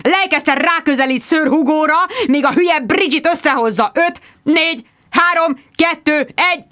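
high, loud barked countdown; countdown shout. Acted. Producer counts down live broadcast, shouting. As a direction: count them in hard.

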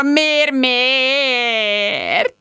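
high, crying plea; tearful wail. A narrator's reading. Rejected Tom wailing to his friends, tearful. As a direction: sob the question, stretching the last word.

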